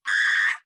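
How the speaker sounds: loud, high mock scream; quick hammed-up cry; imitated yell. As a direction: yell like charging into a wall. Imitates friend running into wall.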